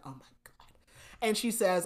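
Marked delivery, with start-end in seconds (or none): 0.1-0.7 very soft, whispered retold dismay. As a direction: breathe it out under your breath. Caught out, said under breath.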